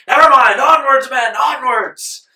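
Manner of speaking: high, booming commander's call; acted general urging men on; rallying shout. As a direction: shout the troops forward, last word drawn out. Washington rallies his men in play.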